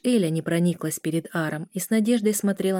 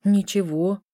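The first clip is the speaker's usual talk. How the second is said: ordinary delivery, nothing marked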